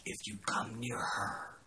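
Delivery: slow, voiceless retold warning; whispered threat. David warns someone to keep away from her, whispered threat. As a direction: hush it into a drawn-out threat.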